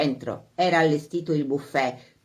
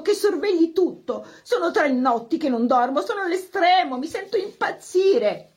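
agitated wife; 9 semitones higher than usual. Acted. Wife frets before the ball.

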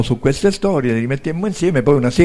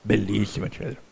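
ordinary delivery

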